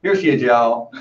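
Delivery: put-on a light Southern accent presenting the dog; playful Southern twang on y'all. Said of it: "a Southern accent that you can give to us".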